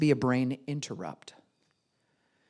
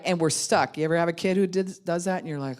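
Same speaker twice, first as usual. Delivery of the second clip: ordinary talk, nothing marked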